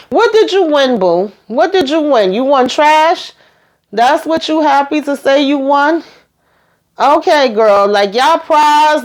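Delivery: high, sassy put-down; sassy mockery. Real, not acted. Vlogger mocking Boston's victory claim, high and sassy. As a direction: needle her for bragging about winning trash.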